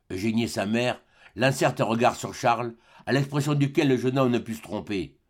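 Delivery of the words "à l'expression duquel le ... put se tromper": usual speaking voice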